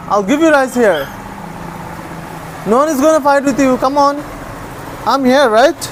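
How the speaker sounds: high-pitched reassurance to a pet; coaxing. Real, not acted. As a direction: persuade a nervous pet to come.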